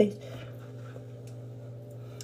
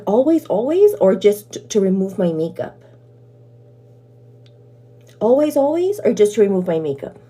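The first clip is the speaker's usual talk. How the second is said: ordinary delivery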